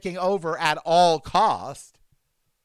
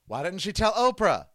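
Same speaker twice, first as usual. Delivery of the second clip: ordinary talk, nothing marked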